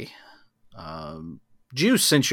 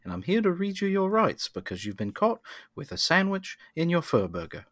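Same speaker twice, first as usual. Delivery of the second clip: quiet, gentle New Zealand voice; big guy speaking softly, New Zealand accent; soft New Zealand Rockman; 3 semitones higher, 6 dB quieter, faster than usual. Acted. Rockman from Thor reads rights, soft New Zealand accent. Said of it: "you say things softly".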